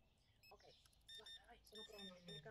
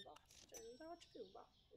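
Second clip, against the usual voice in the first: normal talking voice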